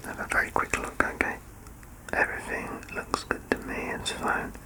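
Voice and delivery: soft, close whispered ear exam; ASMR whisper. Acted. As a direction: whisper gently as you check the ear.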